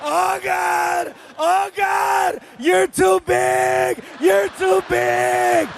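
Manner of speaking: high, slow strained cries under the weight; squashed son crying out. Retold or imitated. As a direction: gasp out from beneath someone heavy. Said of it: "you could hear him".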